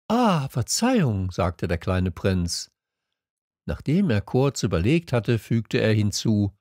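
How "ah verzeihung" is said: high apologetic prince voice; little prince. A narrator's reading. Little prince apologising to the fox, high voice. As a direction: pitch it up as a small polite prince.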